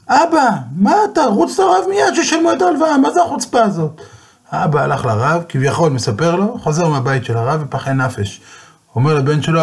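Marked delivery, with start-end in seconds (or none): 0.1-4.0 panicked son's outcry, much higher. Retold or imitated. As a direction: yell at father to run to the rabbi. Son panics over the debt.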